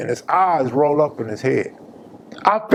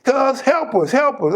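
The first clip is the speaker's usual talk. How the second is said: urgent imitated plea, pitched up; retold yell for help; 9 semitones higher than usual. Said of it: "Wack yells at me".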